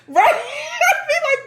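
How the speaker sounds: high laughing squeal; laughing hard. Real, not acted. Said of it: "laughing hard".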